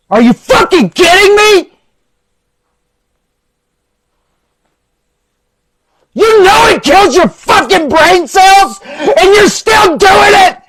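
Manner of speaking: high, screaming rage, slow on the last lines; furious screaming. Acted. Coach screaming at a user who's still doing it, furious. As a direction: scream it at them, voice cracking high.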